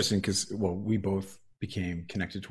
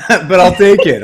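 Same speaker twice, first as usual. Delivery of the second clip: laughing; 11 semitones higher, 21 dB louder than usual. Real, not acted. Laughs at own groggy voice.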